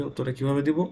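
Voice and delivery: normal talking voice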